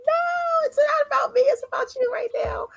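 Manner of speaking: choked-up squeaky insistence; high emotional protest. Retold or imitated. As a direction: protest high, half laughing, half crying. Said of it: "I'm like".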